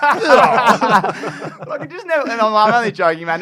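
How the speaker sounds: high and loud through the laughter; can barely get the words out for laughing. Real, not acted. Laughing while retelling.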